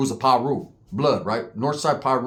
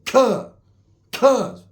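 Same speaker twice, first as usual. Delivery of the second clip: higher, louder cuh with each blow; grunted punches; 8 semitones higher, 4 dB louder, much slower than usual. Retold or imitated. Crip grunting cuh with every hit on the cousin. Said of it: "hitting him like".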